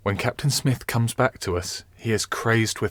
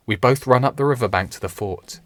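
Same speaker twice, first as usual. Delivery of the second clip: usual speaking voice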